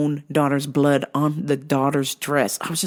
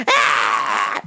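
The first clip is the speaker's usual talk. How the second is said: mock toddler screech; imitated child scream; 8 semitones lower than usual. Child rejecting yogurt from mother, mock scream. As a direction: cut in with a screamed refusal. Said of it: "she starts screaming".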